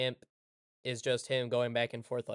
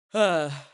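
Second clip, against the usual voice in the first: loud sigh; 3 semitones higher, 10 dB louder than usual. Real, not acted. Sighs over the near miss.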